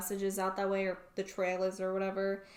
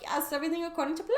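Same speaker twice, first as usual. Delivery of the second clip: high, quick scheming villain voice; gloating king; 8 semitones higher, much faster than usual. Retold or imitated. King gloating to himself about his plan, high and quick. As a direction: rattle it off like a delighted schemer.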